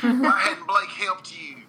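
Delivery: slow, high, hammed-up country twang; super country voice. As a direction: draw it out in a mock country accent. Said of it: "like a super country voice".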